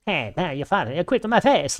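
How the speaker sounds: cartoon Canadian voice jumping high at the end; South Park Canadian. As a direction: play a goofy flappy-head Canuck. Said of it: "the Canadian flappy thing".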